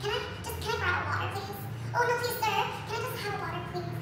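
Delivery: soft, meek begging; timid little plea for water; pleading voice. Retold or imitated. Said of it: "to be like".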